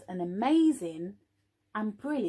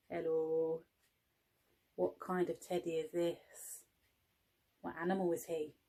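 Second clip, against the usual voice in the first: plain reading voice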